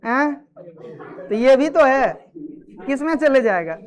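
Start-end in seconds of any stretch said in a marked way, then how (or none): 0.1-0.3 "हैं?" tossed out as a query; questioning exclamation. Shown as a demonstration. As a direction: ask "हैं?" as a one-word reaction. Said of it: "कोई ऐसे भी बोल देगा".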